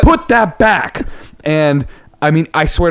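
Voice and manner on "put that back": high, slow shouted order; scolding a child. Retold or imitated. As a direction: reenact a parent's firm telling-off. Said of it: "as if it was a child".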